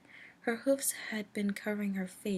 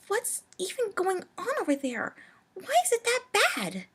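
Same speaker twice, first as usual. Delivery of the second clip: alarmed; 12 semitones higher, 8 dB louder than usual. Acted. Twilight alarmed questions.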